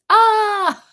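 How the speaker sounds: high, loud "ah!"; startled shriek. Real, not acted. Host sees Vanessa freeze at an inopportune time, high loud shriek. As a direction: cry out sharply at a sudden glitch. Reacts as co-host's video freezes.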